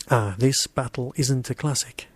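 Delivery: plain reading voice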